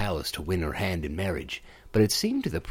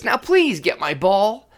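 high, loud, slow insistent please; impatient demand; 11 semitones higher, 7 dB louder than usual. Acted. Princess impatiently orders the frog to fetch her ball. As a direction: demand it, losing patience.

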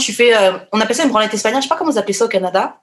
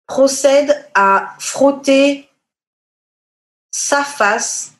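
slow emphasis; 4 semitones higher, much slower than usual. Real, not acted. Slowly spelling out the gross act.

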